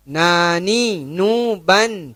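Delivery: "Nani" dengan ujung lidah ringan; light tongue tip on "nuban"; light nun demo. Shown as a demonstration. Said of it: "Huruf nun ini di ujung lidah". ("Nani" ringan)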